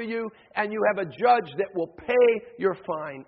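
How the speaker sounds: high, loud, rhythmic sermon build; impassioned preacher at full voice; forceful preaching. Acted. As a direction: pound out each repeated phrase to the congregation.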